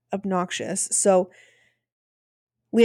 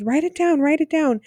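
high-pitched, pleading write it down; high urging quote; 7 semitones higher than usual. Retold or imitated. Wife urging husband at directions stop, high pleading. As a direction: squeak it out, urging him to write it down. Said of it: "She says".

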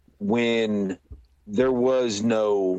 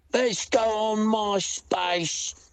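put-on slow, higher common English accent; less posh English; 11 semitones higher, slower than usual. Peasant suggesting MySpace, slow English accent. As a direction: drag it out in a working-class English accent. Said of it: "It'd be more like".

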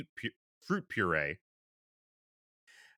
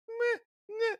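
put-on high-pitched little baby whimper; mimicked infant fussing, two short high bleats; imitated baby fussing. Dad imitating his infant daughter as the match ends, high baby fussing. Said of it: "I hear her go".

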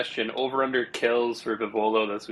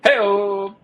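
excited cheer; 8 semitones higher, 7 dB louder than usual. Real, not acted. Cheering the lock pick.

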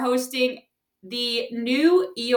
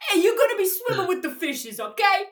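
raised mock mobster threat; Italian mafia accent; 7 semitones higher than usual. Host jokingly threatening the co-host, Italian mafia accent. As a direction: menace playfully in a hammed-up Italian accent. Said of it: "talking Italian mafia".

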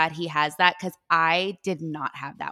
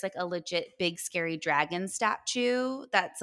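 ordinary talk

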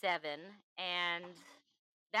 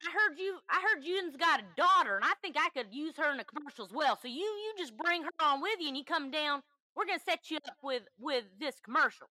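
higher-pitched, exaggerated Southern accent; fake Southern accent; 7 semitones higher, 4 dB louder than usual. Caller asking to use her daughter in a commercial, fake Southern accent. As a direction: ham up a bad Southern twang. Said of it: "I did the worst accent I could".

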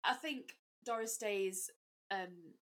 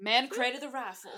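hammed-up Southern twang, louder; Southern accent; 9 dB louder than usual. Finishing the Mean Girls quote for laughs, loud Southern accent. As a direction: proclaim it like scripture in a Southern accent. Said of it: "reminds me of the opening of Mean Girls".

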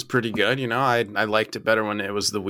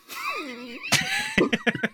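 shrieking laugh. Real, not acted. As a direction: burst into a screeching laugh.

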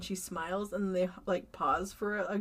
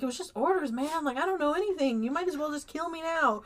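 mock pleading; 7 semitones higher than usual. Cornered killer pleading, retold.

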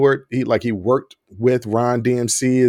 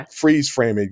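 ordinary talk

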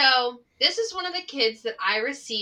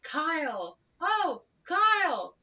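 high, drawn-out mimicked reply; imitating a viral video; TikTok sound imitation; 7 semitones higher, slower than usual. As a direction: stretch the name out in mimicry. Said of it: "TikTok sound of".

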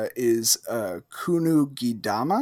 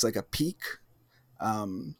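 ordinary talk, nothing marked

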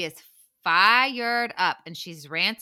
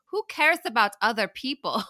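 mock thick German accent, a bit higher; 6 semitones higher, 4 dB louder than usual. Podcaster mimicking a cast member, hammed-up German accent. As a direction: say it in a put-on heavy German accent. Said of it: "a very thick German accent say".

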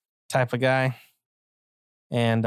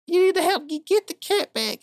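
much higher, breathless lady voice; a desperate client pleading; frantic woman. Acted. Woman client begs the detective for help, high and squeaky.

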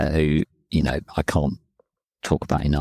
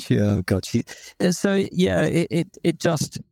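laughing, voice jumps much higher; 10 semitones higher than usual. Real, not acted. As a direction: giggle through the words, pitched up. Laughing after joke about wife.

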